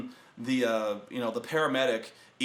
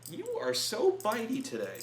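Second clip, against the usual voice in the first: high-pitched cooing to a pet; pet talk; 10 semitones higher than usual. Real, not acted. Owner to a pet that keeps biting, high pet talk. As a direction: scold a pet fondly, pitched way up.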